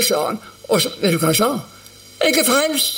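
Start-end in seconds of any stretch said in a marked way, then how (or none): 2.2-3.0 joyful exclaim. Retold or imitated. Dying cousin tells the preacher he is saved, joyful lift. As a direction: say it like a saved man.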